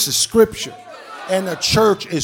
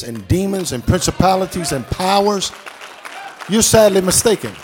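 building sermon, louder and louder; preaching at full force; 2 semitones lower, 7 dB louder than usual. Acted. Pastor preaching hard to his congregation, building loud.

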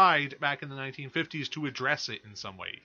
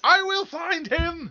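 high, loud, slow menacing vow; hammed-up movie villain threat; dramatic Dune quote; 6 dB louder, slower than usual. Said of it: "that's Dune".

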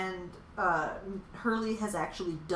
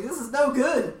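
loud, high panicked quote; hammed-up dismay; mock alarm; 7 semitones higher, 9 dB louder than usual. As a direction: cry out in comic alarm. Imitates Hurley alarmed at arrest.

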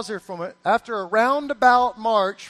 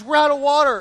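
raised, loud mock alarm; exclaimed realization; 4 semitones higher, 7 dB louder than usual. Preacher voicing the king's realization about water, alarmed exclamation. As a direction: act out a king suddenly realizing the trouble. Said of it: "that's how he said it".

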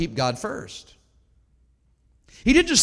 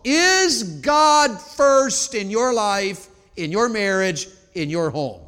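searching question; 3 semitones higher, 3 dB louder, much slower than usual. Acted. Preacher to congregation, slow, loud searching question. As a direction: draw out every word of the challenge.

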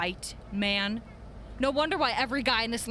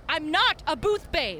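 higher, louder, slower protest; indignant; 6 semitones higher, 4 dB louder than usual. Acted. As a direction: bristle at the insult. Indignant blogger.

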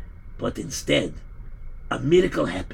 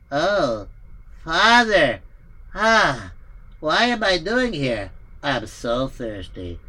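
put-on higher, bewildered young voice; dazed son waking; 4 semitones higher, slower than usual. Son waking from madness asks the father for a drink. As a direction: come to, confused and thirsty. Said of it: "And Peter said".

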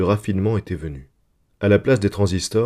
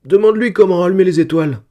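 put-on high, loud tearful little girl; child sobbing; 10 semitones higher, 7 dB louder, faster than usual. A narrator's reading. Upset daughter begging papa to ask Microvac, sobbing. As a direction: sob it out in a small child's voice. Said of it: "sanglota Gérodette un".

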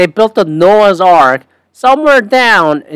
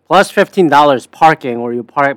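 ordinary talk, nothing marked

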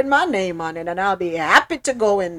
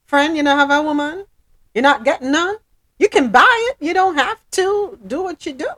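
mocking; 7 semitones higher, 4 dB louder than usual. Mocking the old man.

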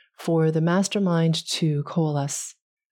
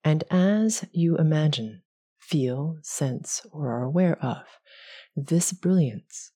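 guided meditation; 2 semitones higher than usual. Acted. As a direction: ease them through each breath. Leads a visualization.

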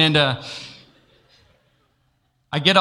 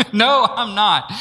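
laughing protest; 6 semitones higher, 4 dB louder, slower than usual. Retold or imitated. Arguing with God, laughing.

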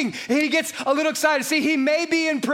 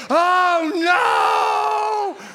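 loud, higher hammed-up cry of despair; mock fan wail; 4 semitones higher, 3 dB louder than usual. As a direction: wail like a fan whose team just lost. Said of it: "you're like".

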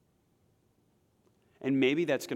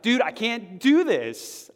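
slow, loud, worn-out cry of giving up; despairing admission; 10 semitones higher, 8 dB louder, much slower than usual. Pretend. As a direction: say it like you've hit the end. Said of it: "be like".

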